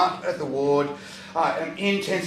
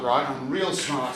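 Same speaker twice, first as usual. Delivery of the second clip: normal talking voice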